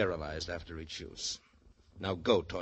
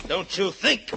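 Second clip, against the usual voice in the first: gloating taunt; 10 semitones higher, 9 dB louder, slower than usual. Acted. Taunting while holding him.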